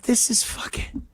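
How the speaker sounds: breathless hushed awe; awed whisper. Retold or imitated. Onlooker watching the polite kid, whispering in awe. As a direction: whisper it in disbelief. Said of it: "You're like".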